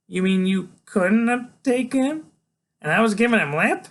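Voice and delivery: put-on high, incredulous kid voice; astonished high-pitched kid; Freak. A narrator's reading. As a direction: ask loud and high, in disbelief.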